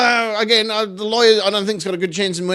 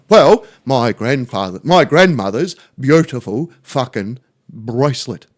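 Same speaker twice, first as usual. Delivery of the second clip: slow, lower dopey bloke voice; a hammed-up, deadpan Terry; 7 semitones lower, slower than usual. Neighbour Terry explaining his girlfriend's mood, slow dopey voice. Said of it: "He goes".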